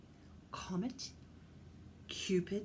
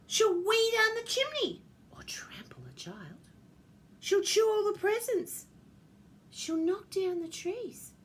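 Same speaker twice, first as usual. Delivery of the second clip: high-pitched indignant griping; complaining; 10 semitones higher, 3 dB louder than usual. Acted. Reindeer complain about what Ruby will do, high indignant griping. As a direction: list grievances in a high voice.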